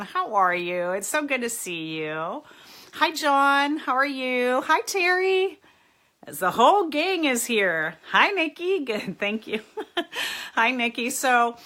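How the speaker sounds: usual speaking voice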